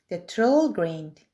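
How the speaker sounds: ordinary delivery, nothing marked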